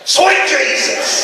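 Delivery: slow, high, loud exclamation; mock preacher outburst; sudden preacher shout. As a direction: jolt them awake. Pastor shouts to wake church.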